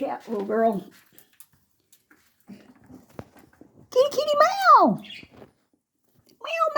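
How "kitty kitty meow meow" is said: high, loud kitty call with meows; cat call. Real, not acted. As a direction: call cats in a falsetto. Calling the cats.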